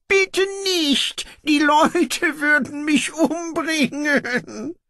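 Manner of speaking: very high, slow, pleading voice; begging usurper. Acted. Antirok begs his brother not to free him, high pleading. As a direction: beg for your life.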